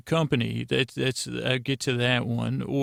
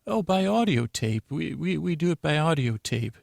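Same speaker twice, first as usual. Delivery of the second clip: higher put-on staffer voice, easing down; lightly acted higher, stammered answer; institute receptionist; 4 semitones higher than usual. Institute staffer tells doctor about delivery, lightly acted higher voice.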